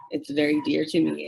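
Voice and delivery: ordinary talk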